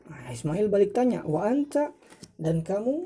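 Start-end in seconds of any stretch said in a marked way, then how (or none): 1.3-2.0 "Wa anta?" with pitch rising high on anta; questioning intonation. Shown as a demonstration. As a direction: lift "anta" sharply as a question. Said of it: "jadi kalau intonasi tanya". ("Wa anta?" dengan intonasi tanya yang naik)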